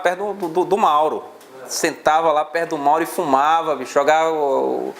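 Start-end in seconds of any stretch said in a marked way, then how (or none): none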